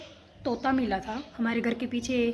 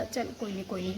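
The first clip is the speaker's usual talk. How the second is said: 1 quiet self-consolation; 4 semitones lower, 10 dB quieter, faster than usual. Retold or imitated.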